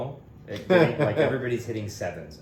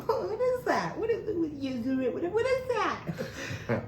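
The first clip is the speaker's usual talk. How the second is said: much higher, laughing mock voice of curious locals; slower than usual. Locals quizzing the Kentucky newcomer, high laughing mock. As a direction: squeal it like baffled locals hearing his accent, slowing on the repeats. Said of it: "you have a different accent".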